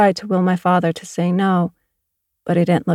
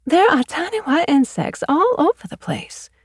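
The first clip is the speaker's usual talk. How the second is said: higher, fussy lady complaining about bugs; fussy neighbour voice; 10 semitones higher than usual. Acted. Fussy neighbour complaining about insects on her car, high voice. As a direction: pitch her up like a fretful neighbour.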